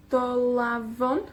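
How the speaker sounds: Dolavon slowly, rising at the end; do-LA-von, slow with a final rise; slow pronunciation. Shown as a demonstration. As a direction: draw out Dolavon, lifting the last syllable. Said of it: "La penúltima".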